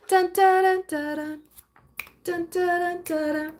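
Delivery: high sung "dun dun dun" fanfare. Acted. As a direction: hum a playful dramatic sting. Dramatic sound effect.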